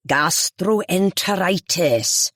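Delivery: Gastroenteritis spelt out slowly, every syllable over-crisp; ornate elocution. A narrator's reading. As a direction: savour each syllable of Gastroenteritis. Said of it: "unnecessarily ornate elocution".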